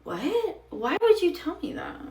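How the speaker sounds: aghast that he'd say that; incredulous. Real, not acted. Outraged at date's remark.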